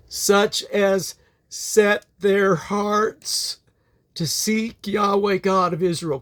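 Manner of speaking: solemn, measured Bible reading; scripture reading. Acted. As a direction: read it like scripture in church, unhurried.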